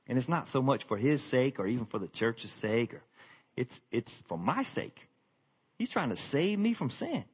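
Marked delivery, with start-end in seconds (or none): none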